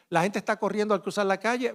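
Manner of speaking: ordinary talk, nothing marked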